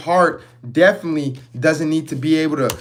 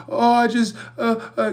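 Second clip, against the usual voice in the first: high, faltering mock stammer; lightly hammed-up hesitant voice; mock people-pleaser; 7 semitones higher than usual. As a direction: play the flustered pushover who can't say no.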